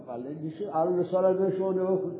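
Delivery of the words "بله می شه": normal talking voice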